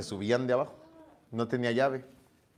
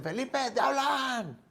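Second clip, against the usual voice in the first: higher, slow call from downstairs; calling upstairs; 9 semitones higher, 6 dB quieter, slower than usual. Retold or imitated. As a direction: call up the stairs lightly. Llaman desde abajo al teléfono. (llamada desde abajo, aguda y lenta)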